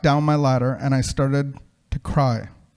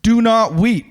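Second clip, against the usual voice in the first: higher, louder call from way above; 6 semitones higher, 5 dB louder than usual. A narrator's reading. A voice from above to the weeping man, calling down. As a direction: ring it out from on high. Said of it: "from way up on high came a voice".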